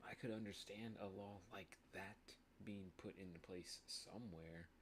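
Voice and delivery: ordinary talk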